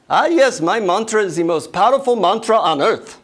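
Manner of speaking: put-on high, loud Indian-accented guru. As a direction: proclaim it grandly in an Indian accent. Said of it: "deep Indian accent".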